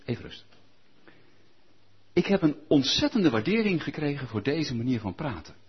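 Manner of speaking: normal talking voice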